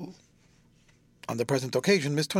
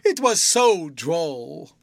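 laughing enjoyment; 6 dB louder, much slower than usual. A narrator's reading. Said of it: "laughing with great enjoyment".

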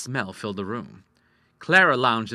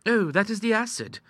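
put-on high, untroubled girlish voice; contented daughter; 11 semitones higher than usual. A narrator's reading. Ida explaining the damage to her father, contentedly. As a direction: answer lightly, pitched high. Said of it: "Ida answered contentedly".

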